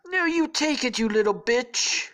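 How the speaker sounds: high, loud, bullying put-on voice; hammed-up presidential impression, domineering; Donald Trump. Trump bullies Gareth over fries, loud hammy impression. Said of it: "says Trump".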